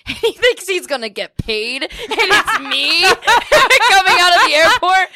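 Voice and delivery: high and loud, laughing through the words; cracking up while picturing the prank. Real, not acted. Laughing at imagined airport prank.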